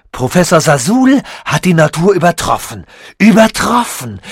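loud, exultant boast; triumphant proclamation. Acted. Sasul proclaims his triumph to Tichy, loud and exultant. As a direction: crow over beating nature.

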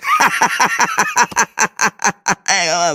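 long, high burst of laughter; hammed-up character laugh. Figur lacht nach eigenem Spruch. (übertriebenes, hohes Figurenlachen)

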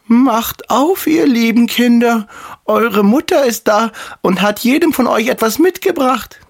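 wolf faking a very high mother's voice; high falsetto, coaxing the children; fine disguised voice. A narrator's reading. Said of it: "machte damit seine Stimme fein". (verstellte feine, hohe Wolfsstimme)

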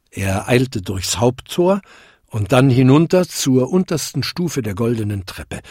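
plain reading voice

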